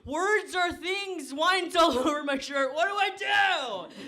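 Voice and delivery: high, slow, rising frantic shout; flustered panic. Retold or imitated. Comic to audience about wine on shirt, high flustered shout. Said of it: "now I'm here and I'm like".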